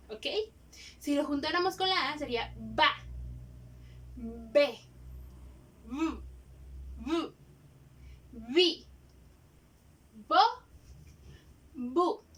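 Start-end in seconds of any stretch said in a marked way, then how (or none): none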